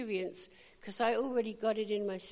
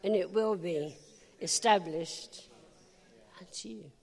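scripture quote; 3 semitones lower than usual. Acted. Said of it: "'Cause it says".

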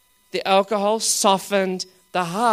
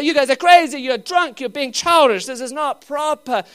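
mock scolding, higher and louder; religious critics; 5 semitones higher, 4 dB louder than usual. Religious spirit judging believers, mock scolding. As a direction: play the disapproving church folk. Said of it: "So they say".

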